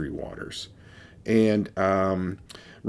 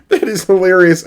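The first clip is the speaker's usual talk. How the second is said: much higher and louder, still laughing; amused; 10 semitones higher, 12 dB louder than usual. Real, not acted. Reviewer reacting to the funny can label, laughing.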